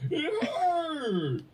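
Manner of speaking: very high, hammed-up wail; milk the pain for laughs; mock whining. Mock complaint repeated after teasing.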